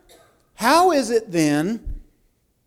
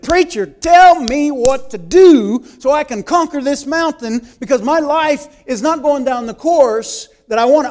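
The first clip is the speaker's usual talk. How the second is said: pleading congregant; 6 semitones higher, 8 dB louder than usual. Retold or imitated. Congregant voiced asking preacher.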